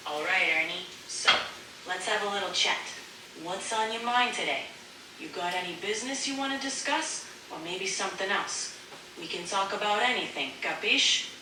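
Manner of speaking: put-on chatty mobster with an Italian accent. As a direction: play an Italian mobster making conversation. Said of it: "as if you're an Italian mobster".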